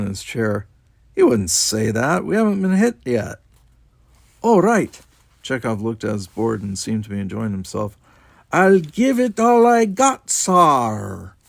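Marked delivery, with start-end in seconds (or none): 1.1-5.0 correcting. A narrator's reading. Correcting each other's role play. 8.5-11.3 very high, loud, drawn-out mock Scottish engineer with Russian accent. A narrator's reading. Chekhov enjoying playing engineer, exaggerated Scottish-Russian accent. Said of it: "kept up that weird accent on accent".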